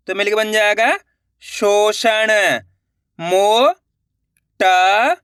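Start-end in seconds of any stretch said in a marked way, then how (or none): 3.2-5.2 motor drawn out very slowly, sound by sound; stretched-out syllables. Shown as a demonstration. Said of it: "अब आगे देखिए". (Motor को बहुत धीरे खींचकर बोलो)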